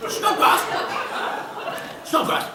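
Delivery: summoning the butler with a laughing call. Acted. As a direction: bellow for the butler, half laughing. Calling for the butler.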